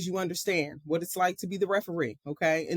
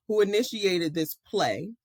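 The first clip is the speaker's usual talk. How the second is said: normal talking voice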